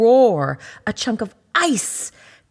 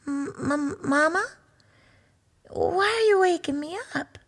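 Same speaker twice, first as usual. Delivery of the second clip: put-on higher, stammering, drowsy child; a cub woken in the dark; high groggy cub voice; sleepy little cub; 8 semitones higher than usual.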